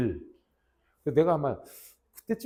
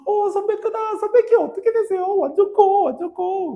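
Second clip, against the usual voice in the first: very high, loud excited squealing; mock fangirl amazement; awestruck junior students; 7 dB louder than usual. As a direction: gush like hammed-up starstruck juniors. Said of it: "후배들이 막 와".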